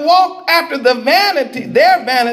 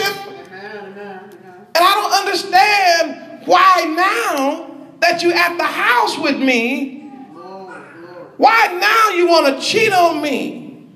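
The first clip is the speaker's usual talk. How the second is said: bewildered betrayed husband; 3 semitones higher than usual. Acted. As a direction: plead to understand the betrayal. Yah as husband asks about cheating.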